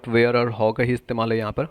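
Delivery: normal talking voice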